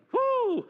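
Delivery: high, loud "woo"; whoop. Real, not acted. Minister catching himself before the congregation, high loud whoop. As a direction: let out a bright, high whoop. Whoops after getting out of breath.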